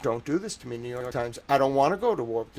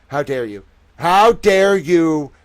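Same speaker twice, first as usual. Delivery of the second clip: slow, louder how dare you; outraged shout; 7 dB louder, slower than usual. Real, not acted. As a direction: drag it out in fury.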